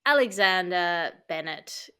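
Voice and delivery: usual speaking voice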